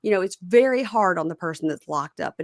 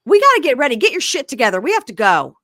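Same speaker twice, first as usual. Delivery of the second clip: loud, high, hurried mom; yelling mom; 6 semitones higher, 6 dB louder, faster than usual. Retold or imitated. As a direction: bark orders to hurry up. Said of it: "mom walks in and goes".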